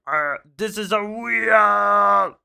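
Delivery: slow, high, loud, booming Bizarro; loud slow dopey Bizarro proclaiming the obvious; dim Bizarro voice. Retold or imitated. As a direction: bellow it slowly like a dim-witted giant copy. Bizarro Superman answers Superman.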